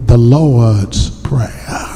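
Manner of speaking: the Lord's Prayer drawn out slowly; slow emphasis. Real, not acted. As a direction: spell it out slowly for the church. Emphatic slow naming for congregation.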